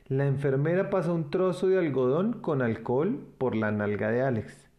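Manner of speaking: usual speaking voice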